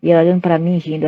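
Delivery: ordinary talk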